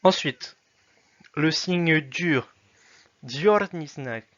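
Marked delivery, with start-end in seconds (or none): 1.3-4.2 le signe dur said very slowly and louder; slow naming. Shown as a demonstration. Teacher names the hard sign slowly. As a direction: spell the name out slowly. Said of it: "naming the letter".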